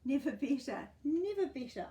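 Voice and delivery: a hint of a bright little boy; boy answering. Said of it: "I would answer".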